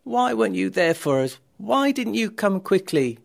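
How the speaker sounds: upset. A narrator's reading. Mary to Jesus, who came too late, upset reproach. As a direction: plead with him, upset and accusing. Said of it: "Mary was just as upset as her sister".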